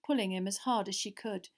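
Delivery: usual speaking voice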